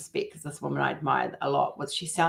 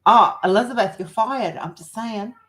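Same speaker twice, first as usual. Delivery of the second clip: lightly imitated mum, higher pitched; a higher, mock-scolding mum voice; mum's voice; 5 semitones higher, 6 dB louder than usual. Mum to daughter Elizabeth, lightly imitated scolding. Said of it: "my mum the other day and she was like".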